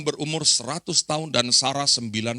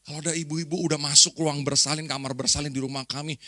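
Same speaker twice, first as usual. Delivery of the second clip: ordinary talk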